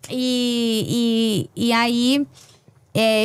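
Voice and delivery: usual speaking voice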